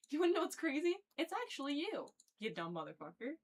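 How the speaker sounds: spring the reveal on him with relish; sassy callout. Retold or imitated.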